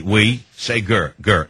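"we" and "gur" said slowly, syllable by syllable. Shown as a demonstration. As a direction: spell out "we, say gur" slowly, like coaching a pupil. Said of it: "Okay, Wolf, say we".

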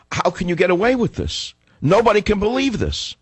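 acted incredulity. Telling the candidate what her circle won't, incredulous. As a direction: voice it high, as if astonished by the nerve.

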